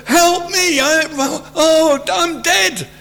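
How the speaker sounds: high, panicked cry for help; acted terror. Preacher paraphrasing Isaiah before God, hammed-up terrified cry. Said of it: "He's absolutely terrified".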